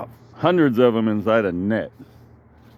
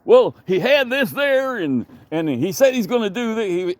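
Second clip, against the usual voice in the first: mimicking the eager man; 11 semitones higher than usual. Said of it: "he was running around trying to say".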